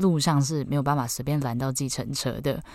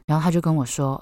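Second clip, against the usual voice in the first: ordinary delivery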